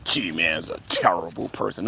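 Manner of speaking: low, soft put-on voice; mock accusation. Streamer parrots critics' charge against G-Man, mocking. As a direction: intone it low and hushed, mockingly.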